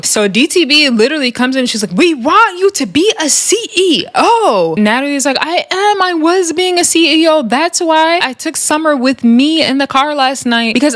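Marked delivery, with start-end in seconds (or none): 2.0-4.8 high, drawn-out mock demand. Recapper mimicking a castmate scolding Natalie, mock demand. Retelling a cast member's demand. 5.4-10.9 high-pitched imitation of defensive protest; mock protest. Recapper mimicking Natalie defending herself, high mock protest.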